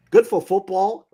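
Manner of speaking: Good for football with Good high and loud; loud emphatic repeat. Shown as a demonstration. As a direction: belt out Good for football as a slogan.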